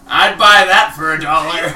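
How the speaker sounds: loud cheesy TV host; TV catchphrase. Retold or imitated. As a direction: belt it like a sleazy game show host. Quoting the movie catchphrase.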